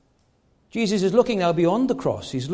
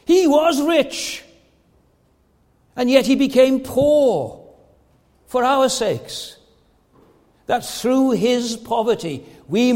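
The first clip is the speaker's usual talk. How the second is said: slow, raised, measured proclamation; solemn emphatic preaching; 4 semitones higher, 4 dB louder, slower than usual. Real, not acted. Preacher tells church the message of the gospel, slow emphatic preaching. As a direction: proclaim each phrase slowly, with weight.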